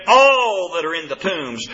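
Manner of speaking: "All" stretched out long and punched; stress on All. Real, not acted. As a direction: hit "All" hard and hold it. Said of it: "tried to emphasize that word all".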